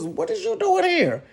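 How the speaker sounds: high surprised question. Retold or imitated. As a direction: pitch it up in disbelief. Kid's father surprised she's home.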